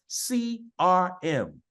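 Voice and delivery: "C R M" slowly, each letter held and separate; letter-by-letter emphasis. Real, not acted. Host making sure the call hears the letters, slow emphatic "C R M". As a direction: spell out "C R M" with weight on every letter. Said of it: "understand the letters that are coming out".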